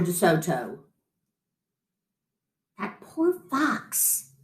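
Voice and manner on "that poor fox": slow, much softer aside; whispered. A narrator's reading. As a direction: hush it to your wife. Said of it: "he whispered to his wife".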